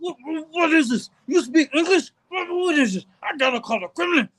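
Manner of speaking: lightly voiced shocked Ivan; mock Ivan. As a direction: play the stunned uncle. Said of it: "Ivan was like".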